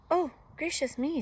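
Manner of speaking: high, mildly shocked exclamation; startled little girl. A narrator's reading. Girl accosted by a wolf, startled.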